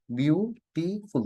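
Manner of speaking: Beautiful slowly split as বিউ-টি-ফুল; syllable by syllable. Shown as a demonstration. Teacher has students count syllables, beautiful said syllable by syllable. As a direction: break beautiful into three drawn-out beats, বিউ, টি, ফুল. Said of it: "তিনটা syllable হয়ে গেছে".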